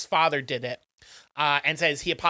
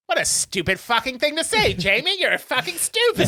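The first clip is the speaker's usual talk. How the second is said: higher-pitched mock teacher's put-down; hammed-up contempt, rising higher; mock scorn; 8 semitones higher, 3 dB louder than usual. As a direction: act out a scathing teacher, playing it for laughs.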